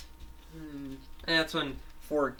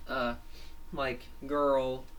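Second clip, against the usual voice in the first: plain reading voice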